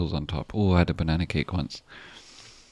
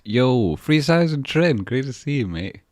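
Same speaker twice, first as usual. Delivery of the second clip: much higher, louder, slow stretched yo; excited greeting; 7 semitones higher, 7 dB louder, slower than usual. Real, not acted. Streamer spotting a regular in chat, thrilled greeting.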